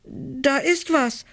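put-on high, slow, shaky stuttering child; terrified girl stuttering at the terrace door; frightened stammer. A narrator's reading. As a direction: stammer it out in fear. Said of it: "stottert sie".

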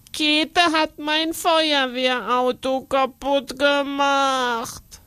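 put-on a child's slow, sulky complaint; lightly voiced angry boy, dragging the words; mildly cross child. Boy complaining to his aunt, slow and sulky. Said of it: "sagt Karlchen böse".

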